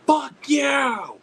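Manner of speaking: high, loud drawn-out retort; mock yelled comeback. Heckler firing back at a fan, mock yell. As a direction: fire it back as a high holler. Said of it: "fire back and be like".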